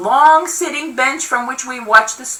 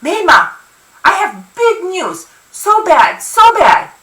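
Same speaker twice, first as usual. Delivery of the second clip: put-on high boyish urgency; high, dramatic little-brother voice; excited boy with news; 7 semitones higher, 3 dB louder than usual. A narrator's reading. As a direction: burst out with big news like a worked-up kid. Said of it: "then immediately forgets and turns to Amorabia".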